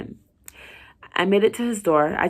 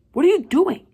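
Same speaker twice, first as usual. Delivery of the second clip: high, loud challenge; startled sharp question; 11 semitones higher, 7 dB louder than usual. Acted. Colleague catching the doctor at the door, loud and high. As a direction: catch her red-handed.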